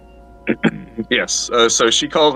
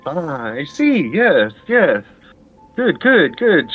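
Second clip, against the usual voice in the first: lady's voice; 6 semitones higher, slower than usual. Acted. Lady reacts to answer.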